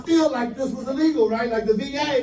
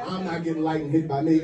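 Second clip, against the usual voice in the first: ordinary talk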